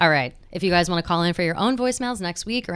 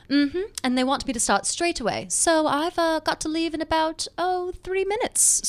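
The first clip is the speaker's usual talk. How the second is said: British Bridget voice; 6 semitones higher than usual. Acted. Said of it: "I'll be Bridget".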